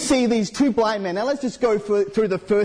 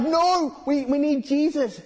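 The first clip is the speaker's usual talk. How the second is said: blind men insisting; 7 semitones higher than usual. Acted. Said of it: "They're like".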